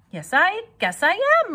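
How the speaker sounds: put-on high squealy young pig. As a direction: play Wilbur high and eager. Wilbur voiced by narrator.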